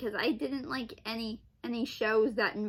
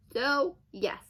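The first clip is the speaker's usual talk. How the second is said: normal talking voice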